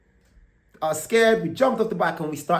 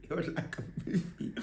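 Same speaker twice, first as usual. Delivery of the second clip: breathy whisper, much softer; 2 semitones higher, 14 dB quieter than usual. Real, not acted. Repeating the line to viewers in a hushed whisper. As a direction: whisper it like a secret.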